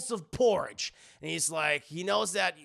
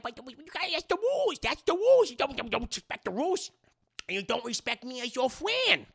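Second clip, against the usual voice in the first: whiny insistence; 4 semitones higher than usual. Acted. Baby bear insisting on rules.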